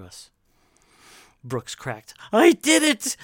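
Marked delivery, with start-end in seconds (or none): 2.3-3.2 cracking under pressure, high full-voiced shout; shouted confession. Brooks confesses before the advancing crowd, shouted. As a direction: blurt it out loud and very high. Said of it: "he shouted".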